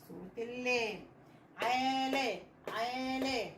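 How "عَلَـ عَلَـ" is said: عالة slowly drawn out as aa-la; slow aa, then la; blending sounds. Shown as a demonstration. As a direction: sound out عالة in two long, even syllables. Teacher blending two syllables aloud.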